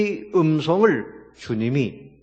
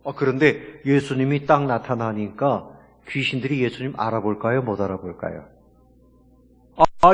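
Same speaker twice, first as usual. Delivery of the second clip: ordinary talk, nothing marked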